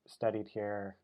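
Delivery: normal talking voice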